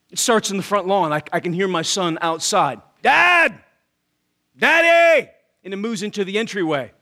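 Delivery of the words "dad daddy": loud, high calling out across the house; child calling for dad. Retold or imitated. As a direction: holler for Dad like a kid searching every room. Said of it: "he's screaming the same thing".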